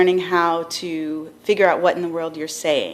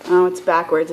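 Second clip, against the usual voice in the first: ordinary talk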